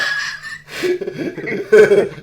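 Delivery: big high burst of laughter; loud laugh. Real, not acted. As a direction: let out a loud, hearty laugh. Laughs at an inside joke.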